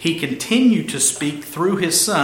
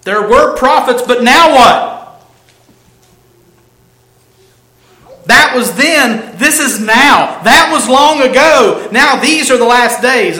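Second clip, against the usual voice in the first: loud, high, punchy declarations; emphatic preaching; 8 semitones higher, 9 dB louder than usual. Real, not acted. Preacher contrasting long ago with these last days, emphatic. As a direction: ring out each short phrase high and loud. Preacher drives home then-versus-now contrast.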